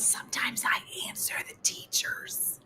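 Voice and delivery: whispered admission. Retold or imitated. Friend repeating her confession, whispered. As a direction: whisper it matter-of-factly.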